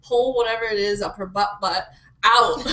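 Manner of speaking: ordinary delivery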